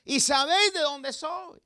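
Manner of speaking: más agudo y algo más fuerte, burlón; échales en cara con ironía; sarcástico. Acted. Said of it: "con un tanto de ironía y sarcasmo". (ironía y sarcasmo)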